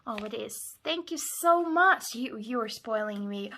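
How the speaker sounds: normal talking voice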